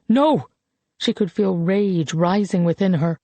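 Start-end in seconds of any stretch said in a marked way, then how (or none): 0.1-0.5 sharp loud No, higher; angry shout. Acted. Colette shouts down the old man, angrily. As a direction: cut him off with a furious cry.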